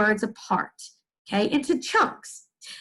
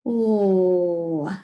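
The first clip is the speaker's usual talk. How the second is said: surprised gasp; 4 semitones lower than usual. Acted. Discovery while reading aloud.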